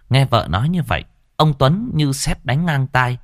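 ordinary delivery

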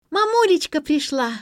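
put-on high, loud joyful child's cry; delighted child. A narrator's reading. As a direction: squeal happily that mum is home. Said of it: "обрадовалась младшая дочь".

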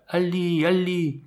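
high-pitched call from afar. A narrator's reading. Ibrahim calls from his tree to his donkey, high cry. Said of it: "lui cria".

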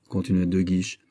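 usual speaking voice

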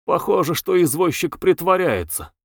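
usual speaking voice

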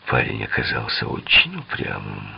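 ordinary delivery, nothing marked

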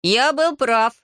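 put-on high, croaking boy's outburst; croaked. A narrator's reading. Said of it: "каркнул Хаята".

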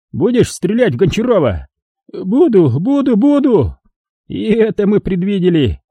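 put-on high, cheerful cruel old man. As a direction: play a folksy sadistic old prospector. Old man runs the execution.

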